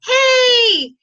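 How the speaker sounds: high, loud cheery hey; excited phone greeting. Acted. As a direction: call out the good news brightly. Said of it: "you're gonna say".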